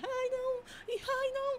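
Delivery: put-on very high childish wail; crying child voice. Demon feigning a child's crying, high imitated wail. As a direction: imitate a little child whining in tears. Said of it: "começou a chorar".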